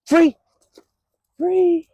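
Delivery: very high sung-out "free"; high release call. Real, not acted. Release cue called to dog.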